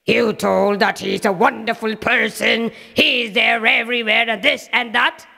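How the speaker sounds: high, cackling scornful taunts; mocking villain laugh. Demon king taunts little Prahalad, mocking laughter. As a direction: sneer and laugh at the child.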